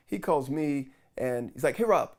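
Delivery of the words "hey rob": higher, louder frantic greeting. Retold or imitated. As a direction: imitate a panicked caller blurting out. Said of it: "and he's frantic".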